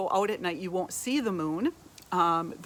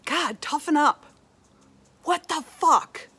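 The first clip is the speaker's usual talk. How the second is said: higher and louder, exasperated mock outburst; scornful critic; 8 semitones higher, 5 dB louder than usual. A harsh critic scolding the sensitive listener, exasperated. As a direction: throw it at them like an impatient bully.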